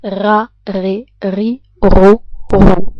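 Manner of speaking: gargled syllables with water in throat; gargling. Shown as a demonstration. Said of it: "This may sound like this".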